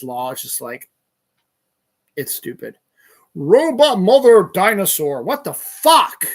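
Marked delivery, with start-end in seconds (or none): 3.3-6.3 loud, high burst of disbelief; real exasperated outburst; exasperated yell. Real, not acted. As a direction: yell it out in bafflement. Baffled outburst at own notes.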